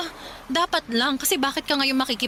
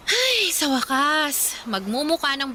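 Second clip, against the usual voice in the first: higher, drawn-out sigh of relief; a long, pleased sigh at last; relieved delight; 6 semitones higher, slower than usual. Acted. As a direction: savor it slowly, finally happy. Finally furnishing their apartment.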